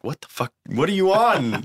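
teasing a friend who bounces in the halls; joking disbelief. Retold or imitated. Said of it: "Like, as a joke".

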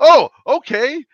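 high, slow stunned oh okay; surprised. Retold or imitated. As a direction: caught completely off guard. Stunned reply to passenger.

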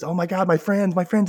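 hammed-up embarrassed fretting; panicked sister. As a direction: imitate a mortified kid spotting friends. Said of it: "the sister hates going to the store".

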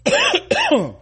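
sharp real coughing from a crumb; coughing fit. Real, not acted. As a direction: cough out a cracker caught wrong. Cracker went down the wrong pipe.

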